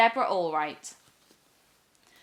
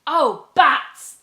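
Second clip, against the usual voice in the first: annoyed; 6 semitones higher, 11 dB louder than usual. Acted. Witch annoyed.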